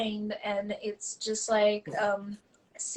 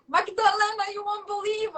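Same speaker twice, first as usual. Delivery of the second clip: excited mom; 8 semitones higher than usual. Retold or imitated. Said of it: "she's like".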